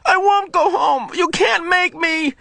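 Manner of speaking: put-on a boy yelling through sobs, pounding the bed; sobbing shout. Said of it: "sobbed and shouted".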